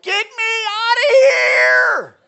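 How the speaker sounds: a sheep crying out to be rescued from the mud; panicked sheep. Acted. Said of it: "And the sheep is like".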